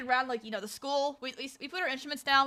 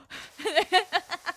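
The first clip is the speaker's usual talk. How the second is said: real high laugh; 6 semitones higher than usual. Real, not acted. Amused by the friends' reaction, real high laughter. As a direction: laugh at your own story. Laughs after acted exchange.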